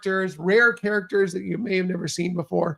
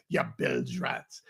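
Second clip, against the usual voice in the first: much lower, slow gruff pirate growl; pirate insult; 7 dB quieter, slower than usual. Pretend. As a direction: snarl it like a cartoon pirate captain. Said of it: "not gonna do this the whole, the whole time".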